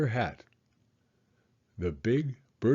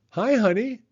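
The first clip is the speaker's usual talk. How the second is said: warm greeting; 10 semitones higher, 5 dB louder than usual. Acted. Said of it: "Her mom and dad came over to greet them".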